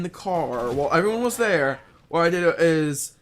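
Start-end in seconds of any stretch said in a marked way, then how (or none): none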